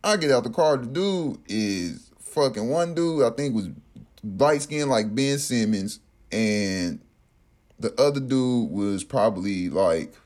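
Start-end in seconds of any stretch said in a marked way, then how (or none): none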